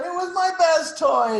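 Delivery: very high, loud mock wail; hammed-up crying protest; crying child. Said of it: "And she's crying".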